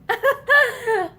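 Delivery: bitter laugh at himself; hollow self-mocking laugh; self-scornful laugh. A narrator's reading. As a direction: scoff at yourself. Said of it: "He laughed in self-scorn".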